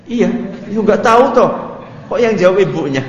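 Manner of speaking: high, loud playful jab at the crowd; loud, high teasing of the men when the mothers answer. Real, not acted. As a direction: tease them loudly as they laugh. Teasing the audience.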